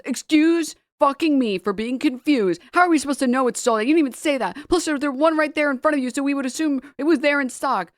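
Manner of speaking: high-pitched, rapid indignant rant; mock indignation. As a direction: read out an offended reply in a rush. Acting out an indignant online reply.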